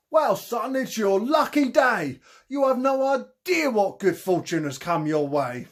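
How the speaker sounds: a grand Cockney hustler pitching a mark; Cockney con man. Acted. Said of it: "His thick Cockney accent".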